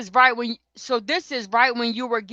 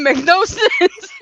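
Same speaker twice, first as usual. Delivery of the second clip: slow, louder, laughing through the words; 9 semitones higher, 9 dB louder, slower than usual. Real, not acted. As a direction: laugh mid-sentence. Laughing while talking.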